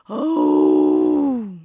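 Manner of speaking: loud, long, low bellow; drawn-out bear "ooh"; bear roar. Acted. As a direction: roar out to frighten someone. Bear jumps out to scare.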